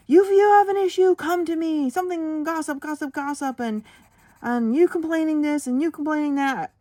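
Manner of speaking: high, loud mock-angry manager rant; retold yelling. Teacher imitates her manager yelling at students. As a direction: belt it out like a furious boss. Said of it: "She's yelling at them".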